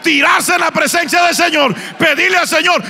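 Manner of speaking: loud, high, chanted sermon climax; fiery preaching. Acted. Preacher calls the congregation to the altar, loud chanted preaching. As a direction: hammer it at the congregation.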